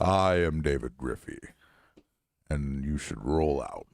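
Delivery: mock heroic robot leader voice; Optimus Prime. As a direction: announce yourself like a robot commander, measured pauses. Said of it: "either Stitch or Optimus Prime".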